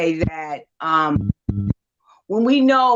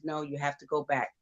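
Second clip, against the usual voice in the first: lower, softer retold command; lightly voiced low authority; deep voice; 4 semitones lower, 5 dB quieter than usual. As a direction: voice a calm deep spirit, gently. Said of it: "a deep voice said".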